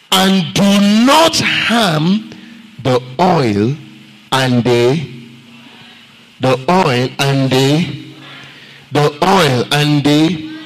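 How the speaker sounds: slow, drawn-out scripture reading; weighty Bible reading; solemn scripture reading. Acted. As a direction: read the verse slowly and solemnly.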